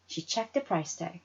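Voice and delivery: ordinary delivery, nothing marked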